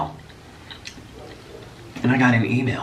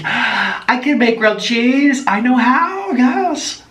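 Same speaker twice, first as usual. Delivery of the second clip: eager; 11 semitones higher, much slower than usual. Retold or imitated. Eager answer to interviewer.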